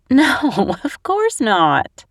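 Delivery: laughing through the words. A narrator's reading. Lou laughing at Spencer's naive question, laughing hard. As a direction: burst out laughing at his innocence. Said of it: "I doubled over with laughter".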